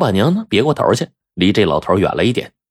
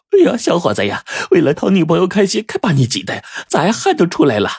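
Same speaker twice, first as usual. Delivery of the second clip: high-pitched teasing landlady; amused landlady; 10 semitones higher than usual. A narrator's reading. Landlady teasing a sweating young suitor, amused high voice. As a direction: tease the lad in a high voice. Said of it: "老板娘捂嘴一笑".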